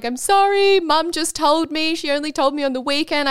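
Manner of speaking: high-pitched mock-tearful plea; imitated tearful apology. Sister confessing mum's secret in the car, imitated tearful apology. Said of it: "she's crying and she's like".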